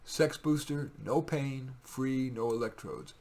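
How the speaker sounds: normal talking voice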